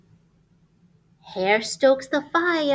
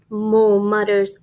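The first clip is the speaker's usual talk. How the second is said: ordinary delivery, nothing marked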